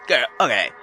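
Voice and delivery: normal talking voice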